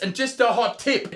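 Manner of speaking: ordinary talk, nothing marked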